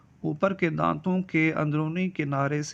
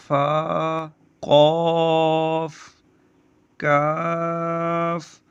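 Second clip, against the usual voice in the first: very slow, sustained letter names on a level pitch; measured tajweed-style modelling of single letters; Arabic alphabet recitation; 5 dB louder, much slower than usual. Shown as a demonstration. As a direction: draw each letter out long, with a pause after it.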